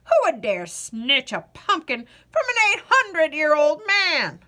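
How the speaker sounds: put-on loud, cracked old man's outrage; an ancient man sputtering in outrage; old man huffing with indignation; indignant old man.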